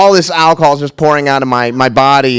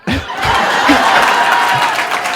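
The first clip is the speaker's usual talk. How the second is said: breathy high laugh; real amused laugh; airy laugh; 10 semitones higher, 4 dB quieter than usual. Real, not acted. Laughing at end of story.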